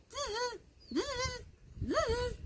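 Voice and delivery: very high, soft buzzing zzz; monkey chatter. A narrator's reading. Monkeys mocking the peddler with high buzzing. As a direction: mimic cheeky monkeys buzzing back.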